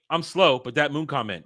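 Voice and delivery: normal talking voice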